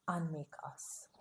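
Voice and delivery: soft whispered ending. Acted. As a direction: whisper it gently. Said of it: "whispered resolution".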